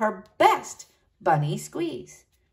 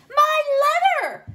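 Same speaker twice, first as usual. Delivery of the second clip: possessive snap; 3 dB louder than usual. Acted. Snatching quarrel.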